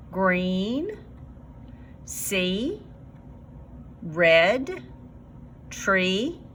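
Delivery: each word alone, rising; slow word list reading. Shown as a demonstration. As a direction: read the list slowly, word by word. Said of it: "Let's read these words".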